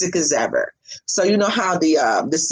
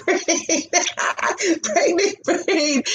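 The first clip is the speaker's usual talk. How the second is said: high, slow giggle through the words; laughing at herself; 11 semitones higher, slower than usual. Real, not acted. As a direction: laugh it out at your own blank. Laughing at her own forgetfulness.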